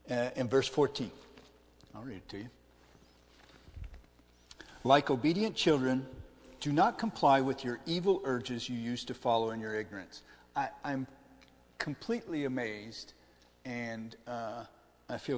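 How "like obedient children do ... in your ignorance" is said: loud, emphatic Bible reading; scripture reading. Acted. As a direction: proclaim the verse to the congregation. Reads verse aloud.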